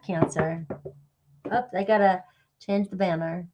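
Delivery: ordinary talk, nothing marked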